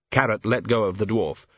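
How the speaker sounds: usual speaking voice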